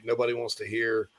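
usual speaking voice

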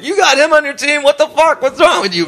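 high, incredulous ribbing from a fellow player; mocking teammate. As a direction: jeer at him in a high squeaky voice. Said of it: "one of the other players is like".

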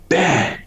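sharp high "bang" like a blow landing; imitated bang. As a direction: punch "bang" out high and quick like a hit. Imitating hitting the gator.

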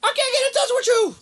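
high mock ghost voice; Buck from heaven. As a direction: squeak it out high as dead Buck. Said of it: "He's like".

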